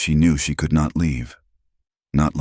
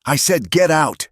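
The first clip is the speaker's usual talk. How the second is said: higher, furious yell; 12 semitones higher, 3 dB louder than usual. A narrator's reading. Rancher ordering Clara off his land, furious yell. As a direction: throw the order at her full force. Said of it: "he yelled".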